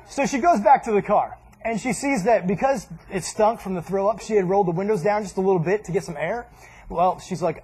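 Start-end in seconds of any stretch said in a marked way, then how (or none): none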